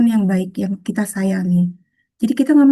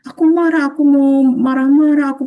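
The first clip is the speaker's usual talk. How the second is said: mock angry outburst; 4 semitones higher than usual. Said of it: "emosinya sedang tinggi sekali".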